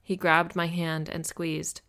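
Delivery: plain reading voice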